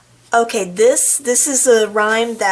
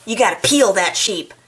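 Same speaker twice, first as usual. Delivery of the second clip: plain reading voice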